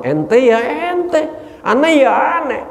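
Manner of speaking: high, slow, cheeky sing-song; insolent retort. Acted. Nafsu still defying Allah, high and insolent. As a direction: drag it out smugly, thumbing your nose. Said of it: "Masih bego".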